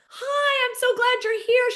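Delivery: high-pitched mock gushing hello; excited greeting. [name] greets an unexpected guest at the show, excited. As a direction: imitate a thrilled, high-voiced welcome.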